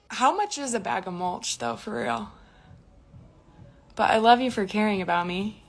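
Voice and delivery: normal talking voice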